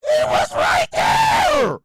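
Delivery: slow, high, shouting in disbelief; loud drawn-out shout. Real, not acted. Streamer yelling at chat in disbelief, slow loud shout. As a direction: stretch it out at the top of your voice.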